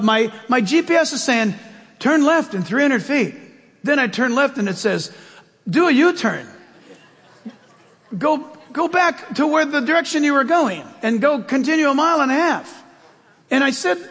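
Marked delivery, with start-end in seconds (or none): 2.0-3.4 lightly voiced female navigation voice, a bit higher; GPS girl voice. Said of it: "it's in a girl's voice". 5.7-12.8 lightly voiced female navigation voice, a bit higher; GPS girl voice. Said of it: "it's in a girl's voice".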